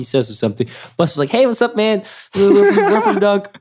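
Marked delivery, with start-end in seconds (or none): 1.3-3.5 much higher, eager and breathless; high excited greeting. Retold or imitated. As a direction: push it way up like a nervous fan. Buster greets player, high hype.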